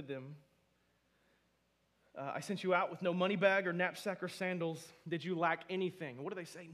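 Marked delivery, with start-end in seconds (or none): 2.3-6.2 loud, raised scripture reading; fervent loud sermon reading; preaching loud. Real, not acted. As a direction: proclaim it to the back row. Preacher reading at full force.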